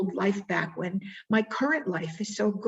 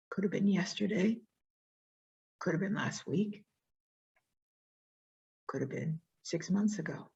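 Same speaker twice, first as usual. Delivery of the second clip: guided reflection; 6 dB quieter than usual. Acted. Speaker guiding the audience back to a painful moment, softly. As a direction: gently lead them back into the moment.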